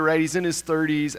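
ordinary delivery

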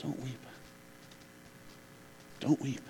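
soft, close, tender hush; gentle whisper. Acted. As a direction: whisper it eye to eye to a grieving mother. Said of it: "looks at her in the eyes and says".

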